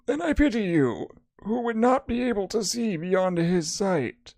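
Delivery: high-pitched mysterious lady; lady character. Acted. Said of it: "Oh my God, it's a lady".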